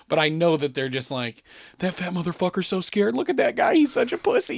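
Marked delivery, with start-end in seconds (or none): 1.8-4.6 high, rising taunt; mock jeering. Carnies below mocking the scared rider, jeering. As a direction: imitate hecklers, climbing higher in pitch.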